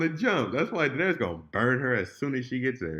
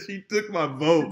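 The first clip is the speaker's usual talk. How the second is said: repeated through laughter, slow and louder; laughing quote; 4 semitones higher, 5 dB louder than usual. Retold or imitated. Retelling Jon's line to his friend, laughing. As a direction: laugh while quoting Jon. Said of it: "He said".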